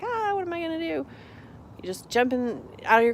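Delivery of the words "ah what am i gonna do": high, fast mock-flustered cry; mock panic. Imitating a panicked driver to viewers, high mock fluster. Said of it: "you don't have to be like".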